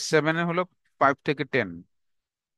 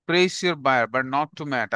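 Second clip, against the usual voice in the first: each word spaced out for notes; slow, deliberate dictation for students to copy; much slower than usual. Shown as a demonstration. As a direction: dictate it slowly to the class. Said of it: "repeated dictation".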